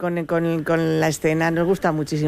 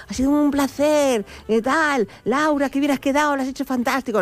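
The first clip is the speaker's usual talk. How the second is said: higher-pitched imitation of an effusive host; gushing farewell; 7 semitones higher than usual. Manu seeing off a guest on air, gushing imitation. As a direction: gush over a departing guest.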